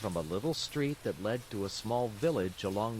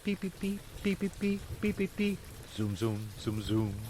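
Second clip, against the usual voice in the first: pipe and drum sounds; 7 semitones higher than usual. A narrator's reading. Narrator mimics distant pipes and drums, playful. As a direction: imitate fife then drum. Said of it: "louder grew the sounds of pipe and drums".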